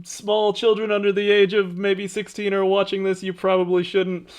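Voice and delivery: booming, high announcer voice. Pretend. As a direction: hype it up like a fight announcer. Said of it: "it's my announcer voice for this episode".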